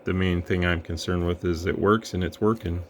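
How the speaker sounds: normal talking voice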